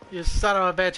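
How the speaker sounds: ordinary talk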